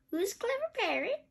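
high parrot mimicry; high bird voice with an upward lilt; Polly. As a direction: parrot the question back, rising at the end. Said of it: "says Polly".

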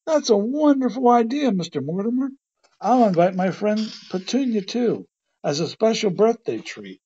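high-pitched, delighted outburst; thrilled storybook character, voice pitched right up. A narrator's reading. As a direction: bubble over with eager delight. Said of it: "Porky was thrilled".

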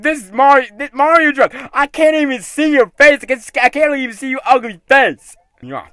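ordinary talk, nothing marked

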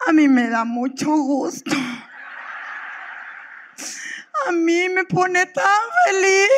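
the weepy friend cries her way through it; mock crying friend. Friend who cries over everything, sobbing with joy. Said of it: "la emocional, esa amiga que por todo llora".

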